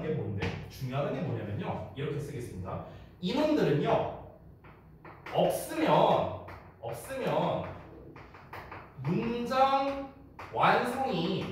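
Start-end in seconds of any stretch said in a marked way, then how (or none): 3.2-11.4 very slow, higher, drawn-out stress; slow emphasis. Shown as a demonstration. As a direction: spell out the key point slowly. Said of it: "teacher stresses key point". (천천히 또박또박 강조하며)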